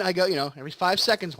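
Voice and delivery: ordinary delivery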